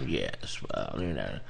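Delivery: low Oliver Reed. Retold or imitated. Oliver Reed answering the hairdresser's questions, very low. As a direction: sink deep and mumble like a weary drunk. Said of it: "He's answering the questions".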